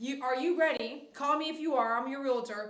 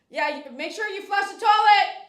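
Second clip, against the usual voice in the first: loud, higher reminder across the room; loud call; 7 semitones higher, 11 dB louder than usual. Real, not acted. Calling instruction to child.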